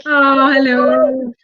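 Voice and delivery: slow, drawn-out cooing hello; cooing at a pet. Real, not acted. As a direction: coo warmly at the dog. Greeting the dog.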